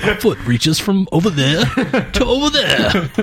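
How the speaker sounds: high, slow theatrical ham voice; hammy stage actor. Pretend. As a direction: overact it, laughing through. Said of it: "didn't overact as much as Daniel Day".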